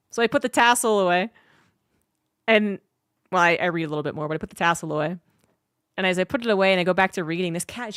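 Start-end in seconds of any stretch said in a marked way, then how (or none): none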